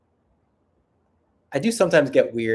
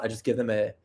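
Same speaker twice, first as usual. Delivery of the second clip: ordinary delivery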